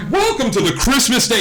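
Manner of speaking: high, loud cheery welcome; chirpy doorman greeting a guest. Acted. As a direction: sing out the welcome like a delighted host. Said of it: "he's like".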